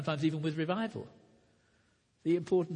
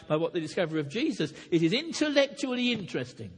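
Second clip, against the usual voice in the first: raised, driving pulpit voice, higher and louder; fiery preaching; 5 semitones higher, 5 dB louder than usual. Acted. Preacher pressing the Pharisees' error on the church, loud and rousing. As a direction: preach it hard to the congregation.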